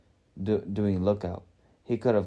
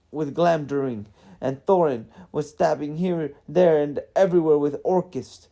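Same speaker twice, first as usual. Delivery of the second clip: higher, agitated dwarf recounting the chaos; raised, emphatic protest in character; exasperated dwarf; 6 semitones higher, 4 dB louder than usual. A narrator's reading. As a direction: pile up excuses as a flustered dwarf.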